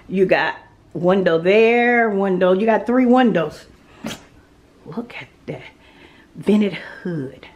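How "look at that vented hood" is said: soft breathy whisper of admiration; awed whisper. Real, not acted. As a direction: whisper it like a secret treasure. Bewundert die Dunstabzugshaube.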